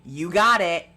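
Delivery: loud, brief imitation of yelling; retold yell. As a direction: punch it out loudly as a mock yell. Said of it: "a man yelling".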